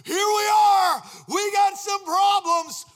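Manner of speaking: high, loud pleading cry; desperate cry to God. Retold or imitated. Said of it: "I think it was more like".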